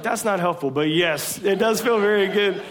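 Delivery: a father conceding with a laugh in his voice; wry admission. Retold or imitated. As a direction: admit it grudgingly to the son. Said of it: "And I was like".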